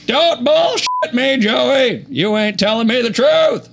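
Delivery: high, slow, shouted accusation; angry Bill Clinton. Acted. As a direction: snap at him in a strained Clinton voice. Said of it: "play this role as Bill Clinton".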